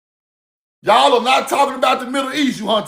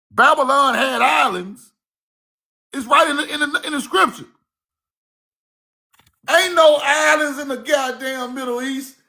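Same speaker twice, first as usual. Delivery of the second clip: normal talking voice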